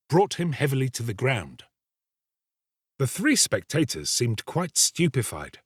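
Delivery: ordinary delivery, nothing marked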